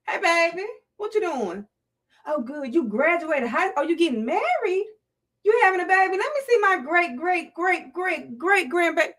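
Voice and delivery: lightly voiced grandma delighted at the news; doting grandma. AI grandma greeting her great grandbaby with mild delight. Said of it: "the A I version of me".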